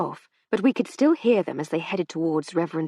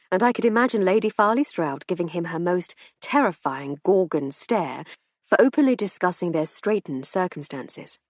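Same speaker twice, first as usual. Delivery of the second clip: usual speaking voice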